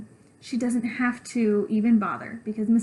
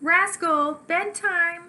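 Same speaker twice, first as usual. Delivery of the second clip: lightly voiced mom calling, higher; 6 semitones higher, 3 dB louder, much slower than usual. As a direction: call the kid to bed from another room. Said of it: "calls my mom".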